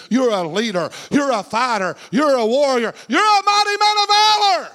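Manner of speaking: building from firm to a high, loud shout; Spirit's rousing declaration. Acted. The Spirit affirming a doubting man, building to a shout. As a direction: proclaim each title bigger, topping out in a sustained cry. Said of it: "the voice of the Spirit saying".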